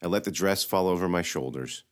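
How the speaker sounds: ordinary delivery, nothing marked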